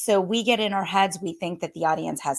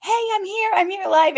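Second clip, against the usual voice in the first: high, bright mock-excited greeting, much higher; excited viewers; 12 semitones higher, 5 dB louder than usual. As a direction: play the eager viewer just arriving live. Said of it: "and you're like".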